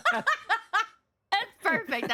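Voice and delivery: high, slow laugh-through "perfect"; laughing delight. Real, not acted. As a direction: crack up while praising. Host laughing at guest's singing.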